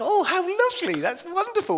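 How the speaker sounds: high, gushing fake enthusiasm; mock delight. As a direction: overdo how lovely it is. Acts his exaggerated pleased reply.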